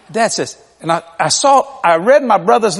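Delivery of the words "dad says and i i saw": usual speaking voice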